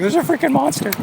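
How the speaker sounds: high squeaky panicked dog voice; voicing the panicked dog. Runner voicing his dog Conan about the truck, high squeal. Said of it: "Conan is freaking out. He's like".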